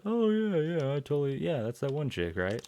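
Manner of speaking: high, mocking fake-eager yeah; mock sudden recognition. Dean faking that he recognizes the girl, mock eager.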